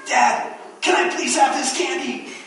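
put-on high child voice, pleading; child begging dad for candy in a high voice; begging kid. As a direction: whine like a kid at the checkout. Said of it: "the kids".

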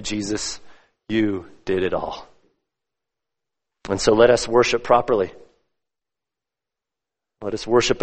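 low, hushed, reverent praying voice; prayer. Real, not acted. Preacher thanks the Father for Christ's sacrifice, in low, reverent prayer. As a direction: pray unhurriedly, pausing between phrases.

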